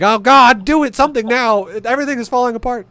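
high loud frantic cry; frantic StarCraft player losing it; panicking player. Retold or imitated. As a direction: shout in a total panic. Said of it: "so they fucking panic".

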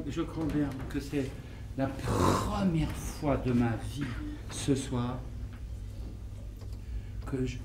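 quiet tipsy confession. Acted. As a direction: confess it softly, a little drunk. Drunk confession, low and soft.

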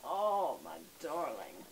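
low, slow cooing to the dog; tender coo. Real, not acted. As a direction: melt over the puppy.

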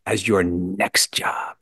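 a slow whispered punchline; hushed, slow wry whisper; whispered aside. Acted. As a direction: lean in and whisper it like a secret. Wry aside about acting life.

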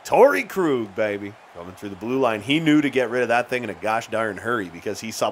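ordinary delivery

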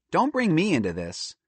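put-on high girl's protest; mildly put-out girl; indignant refusal. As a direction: back out of the scheme, pitched high.

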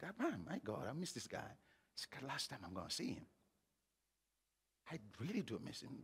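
hushed, sorrowful recollection; quiet grief. Real, not acted. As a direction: speak very softly, missing someone dearly. Recalls grief at father's casket.

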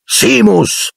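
high-pitched call to a pupil; roared name. A narrator's reading. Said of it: "ruggì il professor Lupin". (nome gridato con voce acuta)

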